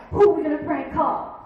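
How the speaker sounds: loud, eager slumber-party shout; rally the friends; excited kid. Retold or imitated. Kids at a slumber party picking a target, loud and eager. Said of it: "you're like".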